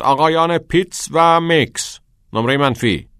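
high, loud reprimand; sour, stern. A narrator's reading. Old teacher punishing two whispering boys, sour and stern. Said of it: "با ترشرویی گفت".

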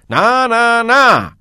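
loud, high, impassioned teacher's cry; shouting. Acted. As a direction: cry out and lecture the boys urgently. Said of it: "کتینگ فریاد زد".

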